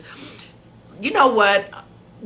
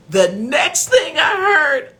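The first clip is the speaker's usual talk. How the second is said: words squeezed out through laughter; can barely talk for laughing; cracking up mid-sentence; laughing hard; 9 semitones higher, 5 dB louder, slower than usual. Real, not acted. Laughing fit before retelling.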